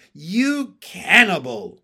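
loud, slow accusation; slow, hateful, emphatic; cold hatred. A narrator's reading. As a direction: spit it out with cold hate. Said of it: "I said with cold hatred".

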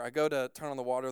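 ordinary talk

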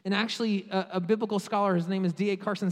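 ordinary talk, nothing marked

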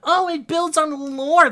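high, slow mocking whine. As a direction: sneer at the argument. Mocking fans' argument.

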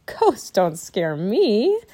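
put-on slow, boastful boy; laughing bravado. A narrator's reading. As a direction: draw it out with breezy bravado. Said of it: "Teddy just laughed".

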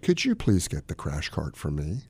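low, soft, polite request; demonstrating a composed, hushed request; unraised calm voice. Acted. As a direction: keep it calm and quietly courteous. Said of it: "you never raise your voice".

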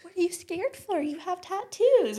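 high-pitched mock questioner; mocking imitation. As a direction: parody their silly objection way up high, drawing out the end. Mimics people questioning her needle fear.

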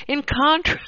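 real helpless giggles cutting the words off; breaking into laughter. Real, not acted. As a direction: crack up mid-word and give up. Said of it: "get through this without laughing".

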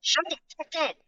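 high, snappy order; mock bossy snap. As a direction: bark it like a fake boss. Third, high pitched take.

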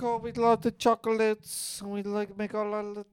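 high, put-on German accent; hammed-up German accent, chirpy and light; mock German accent. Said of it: "is this a German accent".

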